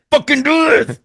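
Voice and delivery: high-pitched mock yell of an order; mock shout. Co-host retelling his partner forcing him, mock high yell. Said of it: "head and say".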